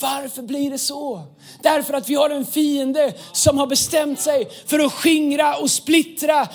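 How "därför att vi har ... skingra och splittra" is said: raised, insistent preacher's voice; forceful preaching. Real, not acted. Preacher warning the church about the enemy who scatters, forceful preaching. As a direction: preach it with building urgency.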